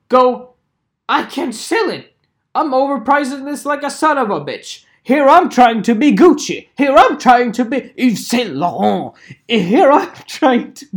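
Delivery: high, loud mocking send-up; hammed-up overpricer's confession; mock boastful seller.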